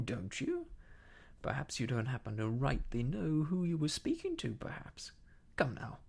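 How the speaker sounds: scornful grilling of an underling; mocking interrogation. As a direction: throw the words back scornfully, then demand answers. Silver mockingly echoes and grills Morgan.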